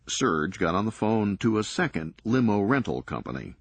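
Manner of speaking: plain reading voice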